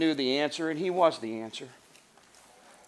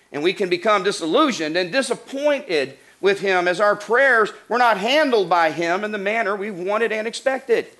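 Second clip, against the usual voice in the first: louder, rising preacher's climax; preaching at full force; 4 semitones higher, 8 dB louder than usual. Acted. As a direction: build the fire as you warn the congregation. Building sermon climax.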